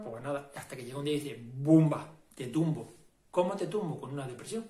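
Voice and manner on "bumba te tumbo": much louder mock knockout call; hammed-up divine boom; booming God. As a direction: slam it down like a blow from above.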